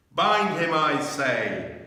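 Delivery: loud, high witch order; witch commanding. A narrator's reading.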